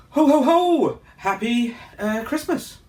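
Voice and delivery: big jolly Santa holiday cheer. Pretend. Christmas greeting, Santa laugh.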